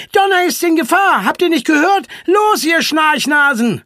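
high, loud, alarmed rallying cry; urgent shout. Acted. Franka rouses friends to save Donna, shouting urgently. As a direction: shout them into action, stretching the last order.

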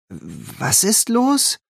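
slow, drowsy high question; half asleep, confused; sleepy mumble. A narrator's reading. Just woken friend asks what's wrong, slow and drowsy. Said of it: "Anne setzte sich verschlafen auf".

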